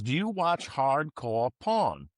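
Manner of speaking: put-on slow, drawn-out Brooklyn accent, "porn" as "pawn"; imitated Brooklyn accent. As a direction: mimic a thick Brooklyn accent, stretching "hardcore porn". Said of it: "I can't do it. My wife does it".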